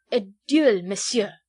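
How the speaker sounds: heated Frenchman's demand; choked with wrath. A narrator's reading. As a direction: force out the challenge in indignation. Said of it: "he stammered".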